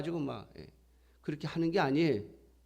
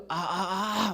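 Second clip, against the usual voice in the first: short, high-pitched cries of "ah"; retold yelps of pain, higher than usual talk; mock pain yell; 5 semitones higher, 3 dB louder, much slower than usual. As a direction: imitate yelling out at a painful injection. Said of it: "제가 막 소리를".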